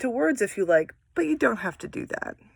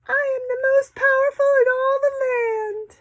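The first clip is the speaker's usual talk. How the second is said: very high tiny mouse voice boasting; squeaky mouse. Acted. Teacher voicing a boastful mouse in a picture book, high squeak. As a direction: squeak it like a proud little mouse. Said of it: "The mouse says".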